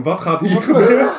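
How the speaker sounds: high, loud mock astonishment; alarmed bystanders. Storyteller voicing stunned people watching a fight, alarmed. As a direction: act out onlookers staring in disbelief.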